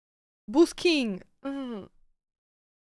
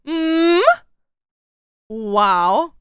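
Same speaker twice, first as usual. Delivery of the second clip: ordinary talk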